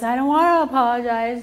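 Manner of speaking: put-on fast, loud, pouty kid refusing; mock a defiant child saying no; whiny child. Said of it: "And the child goes".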